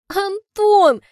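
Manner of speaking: high, loud laughing cry of his name; snorting laugh. Acted. Heroine laughing at her boyfriend, loud snort of a name. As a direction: burst out laughing at him. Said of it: "прыскаю я".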